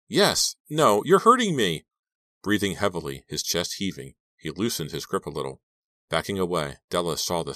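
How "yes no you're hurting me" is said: high, loud panicky cry; frightened, urgent objection; pained protest. Acted. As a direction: cry out as if hurt. Captive protests in pain.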